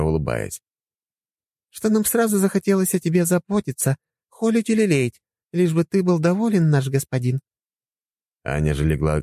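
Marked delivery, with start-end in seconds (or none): 1.7-7.4 higher woman's voice, sly; sly doting wife. Acted. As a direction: dote on him slyly. Helga, slyly smiling. (хитро и ласково)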